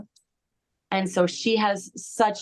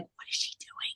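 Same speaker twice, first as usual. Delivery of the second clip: whisper; 13 dB quieter than usual. Retold or imitated. Friend staring during strange ceremony.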